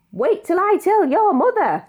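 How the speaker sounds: scolding voice. Acted. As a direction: wag a finger at naughty Floyd.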